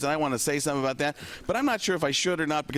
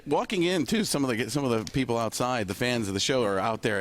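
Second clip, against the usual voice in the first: ordinary talk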